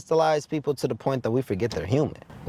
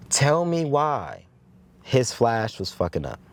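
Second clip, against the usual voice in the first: normal talking voice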